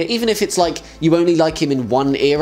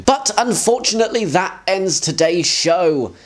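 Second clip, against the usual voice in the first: ordinary talk, nothing marked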